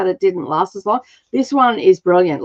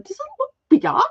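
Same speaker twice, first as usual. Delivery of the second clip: high squeaky voice; faster than usual. Acted. Joking question to viewer.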